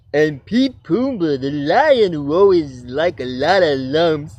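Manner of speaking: a hint of a goofy toon; cartoon character voice.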